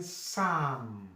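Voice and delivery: "Psalm" as a slow "saahm"; silent P. Shown as a demonstration. Teacher explains silent letters, drawn-out "saahm". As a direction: drop P and L in "Psalm", stretch the vowel and M. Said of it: "The P there is also silent".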